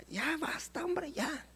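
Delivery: "ya basta" murmured very softly, no authority; "ya basta" breathed out meekly like a timid request; weak request. Pretend. Preacher mocks a meek "ya basta" to the problem, murmured. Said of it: "¿cómo le habla a su problema?".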